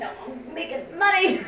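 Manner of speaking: high, bright-voiced comeback; playful retort. Answering Mike's complaint, playful high retort. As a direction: toss it back proudly. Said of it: "I'm like".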